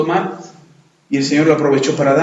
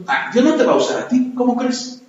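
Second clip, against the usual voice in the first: the devil taunting; 10 semitones higher than usual. Retold or imitated. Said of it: "El diablo se aprovecha ... para decirnos".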